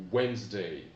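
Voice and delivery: Wednesday said as two syllables, wenz-day; teacher pointing out the silent d; careful pronunciation. Shown as a demonstration. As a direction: stress the first syllable of Wednesday. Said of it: "Attention, le mercredi".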